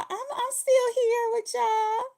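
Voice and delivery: high, slow, whiny fake-crying voice; mock whiny fake cry. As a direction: pretend to sob while insisting you're still there. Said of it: "You could just tell them".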